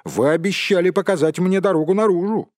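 loud, high, fast demand; insistent demand. A narrator's reading. Bilbo demands the promised way out. (требовательно, быстро и громко)

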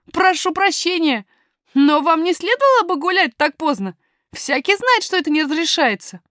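put-on high boy's voice, frank and polite; apologise, then gently scold in a young lad's voice; boy miner, politely reproving.

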